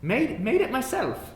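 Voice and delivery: high, proud cook's voice. Acted. Dwarf cook showing off her own stew to the guest, high and proud. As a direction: show off the stew you made. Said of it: "She goes".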